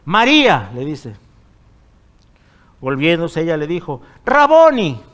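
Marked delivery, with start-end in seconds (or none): 0.0-0.7 called out loud and higher; Jesus calling María aloud; exclaimed name. Acted. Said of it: "está con signos de exclamación". 4.2-5.0 much higher and louder cry of recognition; Mary crying out to her teacher; joyful exclamation. Acted.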